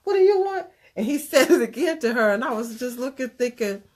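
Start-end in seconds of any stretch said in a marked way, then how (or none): 0.0-0.7 high and bright, cheerfully oblivious; naive coworker. Retold or imitated. Coworker answering the bartender, innocently oblivious and high. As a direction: hand the question back without a clue. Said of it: "She said".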